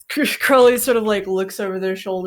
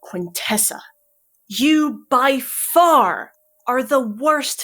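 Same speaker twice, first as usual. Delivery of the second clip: stern commander tearing into a recruit, sometimes higher and louder; Curling, furious mentor; 4 semitones higher, 3 dB louder than usual. Acted. Curling scolds Quintessa over the dead child, in a stern, rising fury. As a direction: berate her like a disappointed drill officer.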